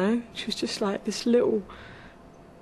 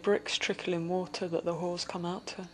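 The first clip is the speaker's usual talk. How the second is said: spoken word poem; 4 semitones lower, 5 dB quieter than usual. Acted. Sex worker reciting her bleak street poem, brooding. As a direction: let the words drip with grit.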